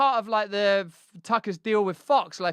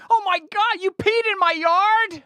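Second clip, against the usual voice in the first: high, loud, slow mock indignation; mock-outraged high exclamation; Tucker-style outrage; 10 semitones higher, 5 dB louder, slower than usual. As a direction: ham up a scandalised anchor. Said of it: "their mad laughs".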